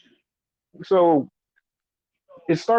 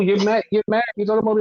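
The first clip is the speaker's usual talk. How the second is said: ordinary talk, nothing marked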